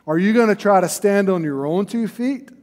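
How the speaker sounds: ordinary talk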